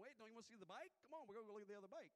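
high, loud, fast pleading call; calling after him. Retold or imitated. Preacher calling the worker back to the bikes, urgent.

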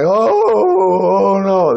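hammed-up weeping; mock crying. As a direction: pretend to bawl, stretching the "oh". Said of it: "Jesus just crying like".

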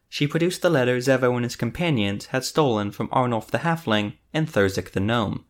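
plain reading voice

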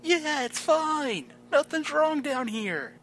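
calling up. Acted. Calling back up from pit.

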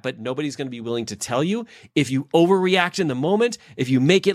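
usual speaking voice

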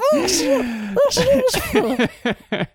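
high, loud burst of laughter. Real, not acted. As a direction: crack up laughing. Laughs at own throat trouble.

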